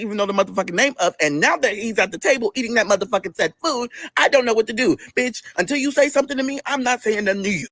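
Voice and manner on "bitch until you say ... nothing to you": usual speaking voice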